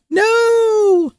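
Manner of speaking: very high squealed No; a hammed-up high-pitched squeal of No; mock shriek. Mock shrieked refusal to policies.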